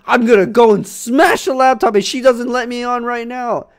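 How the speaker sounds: put-on high, loud childish yelling; angry kid threat. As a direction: rage like a kid denied the laptop. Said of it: "I was like".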